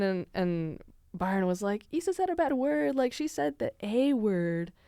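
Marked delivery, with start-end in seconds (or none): 1.9-4.7 high-pitched imitation of a tattling kid; mock tattletale. Classmate reporting to the teacher, high mock tattling. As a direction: tell on a classmate in a higher child's voice.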